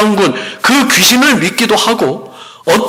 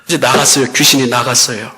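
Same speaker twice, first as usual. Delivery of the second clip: ordinary talk, nothing marked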